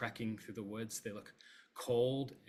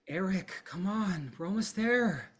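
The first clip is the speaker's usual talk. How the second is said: much higher, louder call to Eric; urging villager; 9 semitones higher, 7 dB louder than usual. Acted. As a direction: push him on, high and loud. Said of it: "person say".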